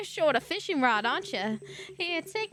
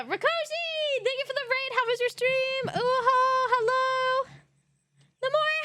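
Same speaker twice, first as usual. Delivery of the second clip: cute high greeting voice; 10 semitones higher than usual. Acted. Streamer greeting raiders from Rakoshi's stream, in a high sugary voice. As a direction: gush over the raiders, bright and high.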